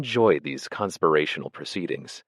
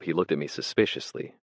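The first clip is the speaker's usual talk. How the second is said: ordinary delivery, nothing marked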